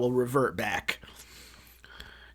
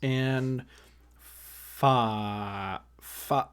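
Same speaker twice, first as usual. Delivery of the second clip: drawn-out slow count to five; much slower than usual. Shown as a demonstration. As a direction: stretch each number out slowly. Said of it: "slowly count to five".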